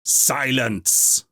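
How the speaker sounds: loud sharp call for silence; shouted command. A narrator's reading. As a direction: housekeeper silencing the gathering. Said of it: "shouted Sathralope".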